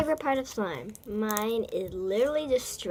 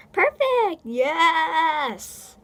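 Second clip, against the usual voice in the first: much higher, louder burst of delight; excited squeal; 6 semitones higher, 7 dB louder, much slower than usual. Real, not acted. Kid cheering her perfect slime swirl, excited squeal. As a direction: squeal with joy at getting it right.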